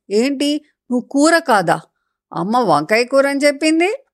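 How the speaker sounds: put-on a little girl amazed the brinjal is a fruit; surprised. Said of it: "అంది baby ఆశ్చర్యంగా".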